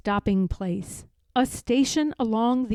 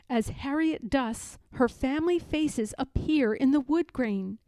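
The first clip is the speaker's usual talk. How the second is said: usual speaking voice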